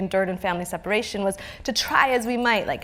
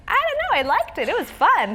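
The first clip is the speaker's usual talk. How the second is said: breezy shrug; 12 semitones higher, 4 dB louder than usual. Retold or imitated. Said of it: "And I'm like".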